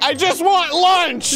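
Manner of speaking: high, slow, drawn-out wail of frustration; hammed-up plea at the end of your rope; exasperated yell. Customer kept waiting at Subway, exasperated yell. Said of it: "And you're like".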